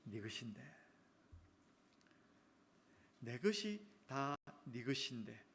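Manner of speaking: soft, low, slow reassurance; tender father. Acted. Father tenderly reassuring elder son, soft and slow. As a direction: let it land softly, slowly.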